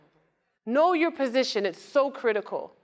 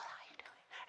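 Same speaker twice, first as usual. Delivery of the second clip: barely audible whisper; imitating a tiny woman's whispered hello; whispered greeting; 29 dB quieter than usual. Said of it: "she talks like this".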